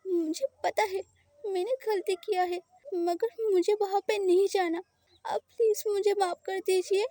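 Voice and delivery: high, tearful pleading through sobs; crying voice. Acted. Frightened boy begs his partner not to lock him up, crying. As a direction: beg for forgiveness while crying. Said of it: "रोने वाला voice में बोलता है".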